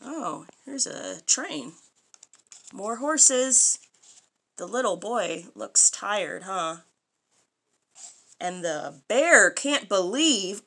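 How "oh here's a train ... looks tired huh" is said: usual speaking voice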